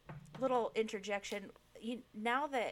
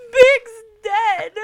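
hammed-up high, loud wail; retold ugly crying; 14 semitones higher, 22 dB louder than usual. Telling husband why she's crying, hammed-up ugly cry. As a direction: wail it through mock sobs, very high. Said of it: "I'm just full on ugly crying".

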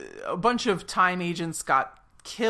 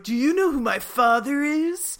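entitled whiny brat; 7 semitones higher, 4 dB louder than usual. Retold or imitated. Entitled prisoner demanding.

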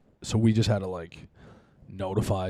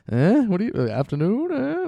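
suggestive teasing; 12 semitones higher, 7 dB louder than usual. Retold or imitated.

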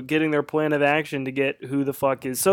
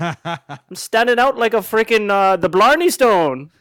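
put-on leprechaun Irish accent; 5 semitones higher, 6 dB louder than usual. Continuing Fiona Irish bit.

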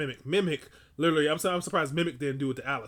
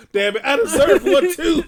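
high, loud, slow laughing complaint; laughing mock whine; 12 semitones higher, 13 dB louder, slower than usual. Real, not acted. Joking jealousy of Luther, laughing high and loud. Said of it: "I was always jealous".

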